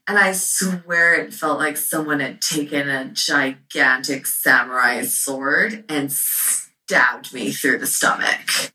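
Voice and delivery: ordinary talk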